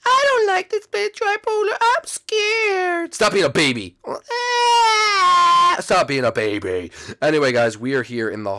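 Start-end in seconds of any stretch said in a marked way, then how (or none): 0.1-3.1 very high, loud, slow squeaky whine; scared sidekick. Acted. Sidekick frightened of spooky world. 4.1-5.8 very high, loud squeal; long babyish shriek; mock scared scream.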